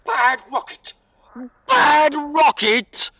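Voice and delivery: indignant, loud, drawn-out cry; outraged shout. Acted. Rocket yells at frogs who called it bad, loud outrage. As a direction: rocket roars back at the insult, furious shout.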